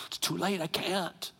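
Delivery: understated, sighing reply; resigned refusal. Retold or imitated. God answering those who begged too late, acted resignation. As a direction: let it out after a sigh, helplessly. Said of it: "I'll sigh and go".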